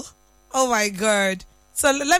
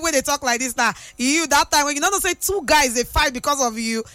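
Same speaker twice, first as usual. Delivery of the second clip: ordinary talk, nothing marked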